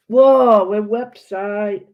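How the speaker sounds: startled as the page pops up; real surprise. Real, not acted. Website suddenly appears.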